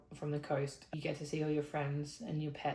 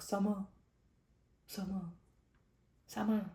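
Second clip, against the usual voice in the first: Australian summer; 3 semitones higher than usual. Shown as a demonstration. Vlogger mocking her own Australian summer. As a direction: repeat summer in an Aussie accent, summah with no r. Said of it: "I sound, like, so Australian".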